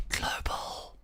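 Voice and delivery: hushed, voiceless whisper; whispered boast. Pretend. Streamer showing off rank to viewers, whispered boast.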